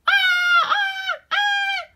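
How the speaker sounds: tiny high repeated screams; spiders screaming. A narrator's reading. Spiders startled by the sheep, high little screams. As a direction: squeak out three frightened screams. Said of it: "screamed the spiders".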